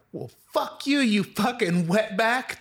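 high, drawn-out insult; imitated angry driver. Driver out of options insulting the storyteller, mock fury. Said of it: "She was like".

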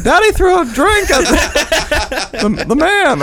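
put-on very high, slow child voice; tattling young daughter. Daughter reporting Daddy's drink throwing at home, high tattling voice. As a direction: imitate a kid telling on Dad. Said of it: "go home and be like".

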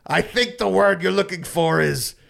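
nasal Penguin snarl ending in a sudden yelp; quoting the Penguin. Retold or imitated. Podcaster quoting the Penguin's line to friends, mock snarl and yelp. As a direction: growl it like Danny DeVito then shriek. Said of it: "And he goes".

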